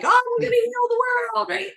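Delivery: very high, loud manic glee; manic euphoria. Retold or imitated. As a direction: sound high as a kite. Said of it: "like, on cocaine of".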